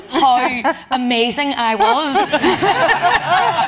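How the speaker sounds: slow, laughing through the words; real laughter. Real, not acted. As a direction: giggle your way through it. Jokey self-praise.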